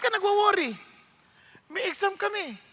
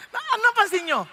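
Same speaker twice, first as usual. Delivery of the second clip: usual speaking voice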